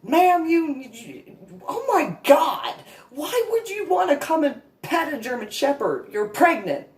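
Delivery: high, loud mock disbelief; exasperated handler. Handler to pregnant woman, loud mock exasperation. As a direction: scold her like she's crazy.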